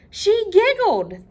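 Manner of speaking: very high, loud and slow whimpering wail; bursting into tears. Acted. The king bursting into tears over the giggle, high wail. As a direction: let him break down into tears. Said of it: "the king went on, and he burst into tears again".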